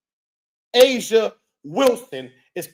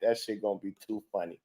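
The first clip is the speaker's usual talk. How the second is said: ordinary talk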